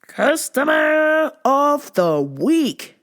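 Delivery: slow, high and loud, like a game show host; announcer voice. Pretend. Host to viewers, introducing the segment as a hammy announcer. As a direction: boom it out as a big title card.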